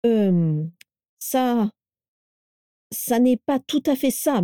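halting, long pauses, pitch climbing; embarrassed stammer. A narrator's reading. Edgar is exposed before his mother, stammering.

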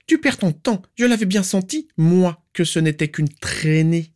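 put-on very high, sharp, demanding old aunt; shrill aunt. Aunt demanding where the niece is, shrill and stern. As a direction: pinch every word with disapproval. Walburga insulting Andromeda.

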